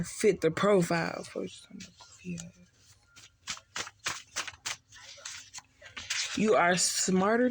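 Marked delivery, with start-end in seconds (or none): 1.2-6.4 barely audible scornful mutter; muttered under breath. Pretend. The same retort, dropped under breath.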